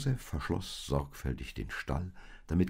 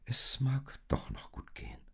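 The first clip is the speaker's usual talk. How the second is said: higher, whispered hope; hopeful whisper; 8 semitones higher, 3 dB quieter than usual. Acted. Maria hopefully whispers to Josef that all may go well. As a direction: breathe it out hopefully. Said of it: "stand sie auf und sagte".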